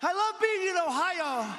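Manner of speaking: hammed-up impression of a confused Biden; mock Biden. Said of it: "And then he goes".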